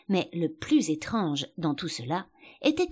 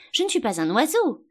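higher-pitched, mildly vexed protest; rather vexed; 6 semitones higher, 4 dB louder than usual. A narrator's reading. Alice protests to the sheep, rather vexed. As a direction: object with a touch of offence. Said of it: "plutôt vexée".

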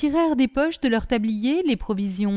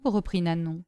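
ordinary delivery, nothing marked

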